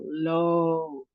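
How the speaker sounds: "lo" with a drawn-out oh; long O. Shown as a demonstration. Teacher showing a long O in the word, long O held. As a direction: hold the O in "lo". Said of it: "we have a long O".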